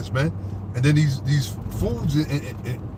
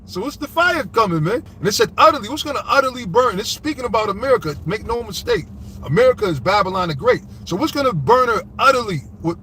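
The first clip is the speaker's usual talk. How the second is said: preaching at full force; 5 semitones higher than usual. Acted. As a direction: thunder the judgment over the congregation. Preaching the fire verse.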